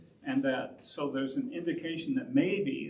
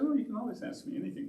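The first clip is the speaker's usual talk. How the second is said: reassuring; 8 semitones higher, much faster than usual. Retold or imitated. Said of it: "I said".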